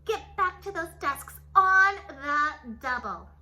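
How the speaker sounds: loud scratchy commanding bark; stern witch teacher. A narrator's reading. Strict teacher barking orders at class, loud. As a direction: order the class around harshly. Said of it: "said Miss Swamp".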